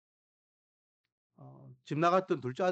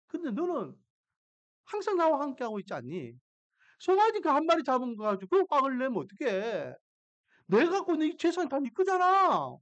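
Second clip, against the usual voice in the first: high-pitched, insistent fatherly reasoning; coaxing reassurance; 8 semitones higher than usual. Retold or imitated. Father soothing his resentful elder son, emphatic coaxing. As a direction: talk the son round, rising to an emphatic plea. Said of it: "아버지가 이 첫째 아들을 다독거립니다".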